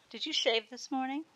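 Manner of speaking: curt. A narrator's reading. Cindy curtly questioning the boyfriend. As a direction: snap the question off briskly. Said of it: "asked Cindy curtly".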